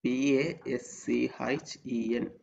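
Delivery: slow, letter by letter; spelling it out. Shown as a demonstration. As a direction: spell each letter slowly for students. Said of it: "എങ്ങനെ വേണം pronounce ചെയ്യുക".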